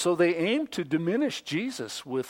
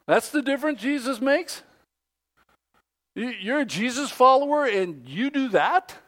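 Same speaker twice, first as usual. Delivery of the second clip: higher, mocking disbelief; scoffing; 6 semitones higher than usual. People scoffing at Christians, higher pitch. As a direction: scoff at the hypocrite. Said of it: "People tend to scoff and go".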